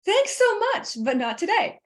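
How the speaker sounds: breezy, friendly refusal, keep walking; a lilt in my voice. Shown as a demonstration. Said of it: "I have a lilt in my voice".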